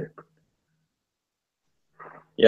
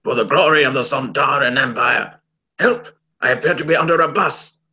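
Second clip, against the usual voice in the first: pompous alien warrior impression; Strax. Strax hailing the Sontaran Empire, declamatory impression. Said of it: "I can do a Strax impression".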